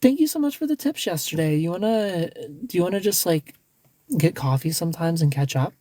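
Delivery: hammed-up bubbly flirt; mock flirty gush. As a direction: gush with thanks, then ask him out. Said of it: "you're like".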